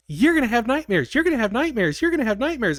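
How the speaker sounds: put-on high sing-song chant of a creepy child; taunting imp. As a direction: mimic a gleeful little imp taunting. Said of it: "dancing around like a fucking imp".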